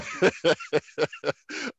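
light, rippling chuckle; a run of soft laughter; amused chuckle. Real, not acted. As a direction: chuckle at your own cheeky aside. Chuckles at his cigarette remark.

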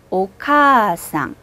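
오카상 with 카 held two beats, slow; 오카상, draw out the 카; long vowel. Shown as a demonstration. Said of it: "두 박자로 발음합니다". (오카상 카를 두 박자로 길게)

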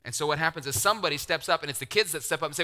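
ordinary delivery

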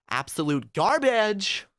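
GARBAGE high and drawn out; voice play for emphasis. Real, not acted. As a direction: swoop up slowly on "garbage". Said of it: "I liked what you did with your voice".

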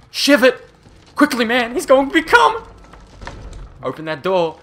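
frantic high-pitched yelling; panicking gamer. Real, not acted. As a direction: scream orders while fleeing in panic. Fleeing infected in horror game.

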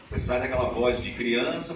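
normal talking voice

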